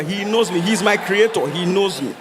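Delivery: ordinary delivery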